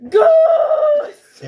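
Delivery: very high, loud laughing yell; taunting shout. Retold or imitated. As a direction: shriek the word to provoke. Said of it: "He's like".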